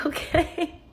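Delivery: high, rising, laughed-through imitation; amused doubtful reply. Stranger answering her fake name, imitated with a laugh.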